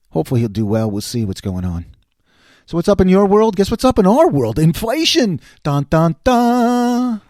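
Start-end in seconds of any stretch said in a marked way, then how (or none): 2.6-7.2 loud, higher showman build-up; dramatic announcement. Pretend. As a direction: announce it like a game show reveal.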